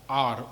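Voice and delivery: R as a long open "aah"; R drawn out, open-throated; English R from the throat. Shown as a demonstration. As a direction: say R slowly as "aar", from the throat. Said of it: "au niveau du gorge".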